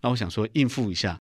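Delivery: usual speaking voice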